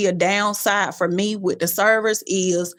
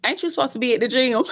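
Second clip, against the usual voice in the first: mock scolding self; 4 semitones higher, faster than usual. Talking to herself in car.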